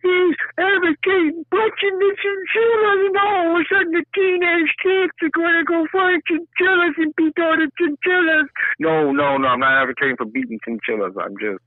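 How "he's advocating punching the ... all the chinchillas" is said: high, slowed mock-alarmed voice; mock outraged critic. Mocking listeners who'd accuse him, high alarmed voice. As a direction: squeal like a scandalized critic. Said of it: "Don't, don't be".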